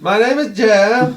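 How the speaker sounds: high, drawn-out goofy holler; dopey catchphrase voice. Acted. Guard salutes out of nowhere, goofy drawn-out holler. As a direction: announce yourself like a proud simpleton.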